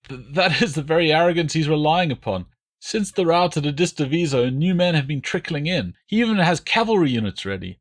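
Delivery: high, frightened begging prisoner voice; pleading deserter. A narrator's reading. Prisoner begging the Roman commanders, high and frightened. Deserter explaining after weak laugh.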